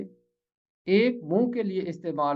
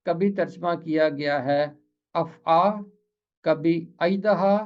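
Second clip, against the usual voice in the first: ordinary delivery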